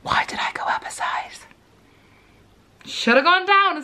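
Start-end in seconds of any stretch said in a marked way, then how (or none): 0.0-1.4 unvoiced whisper to self; hushed, whispered aside; whispered regret. Real, not acted. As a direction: whisper it in disbelief. Mock regret at oversized suit.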